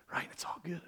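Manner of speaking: very soft, hushed whisper; hushed mock comfort; whispered reassurance. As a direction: whisper it as an acted happy ending. Acted Christmas-movie resolution, whispered.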